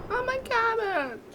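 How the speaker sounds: "Oh, my cabbage!" pitched high and cried out; hammed-up anguish, MY leading; mock cabbage wail. Meme wail, high cry.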